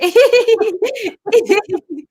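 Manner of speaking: high, loud sustained giggling; shy laughter. Shown as a demonstration. Coach keeping the exercise going, shy laughter. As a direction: keep a high titter rippling along. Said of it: "You keep it going".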